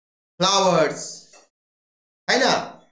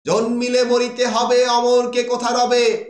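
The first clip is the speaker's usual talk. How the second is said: verse declamation; 5 semitones higher, 4 dB louder than usual. Retold or imitated. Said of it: "Michael বলছে".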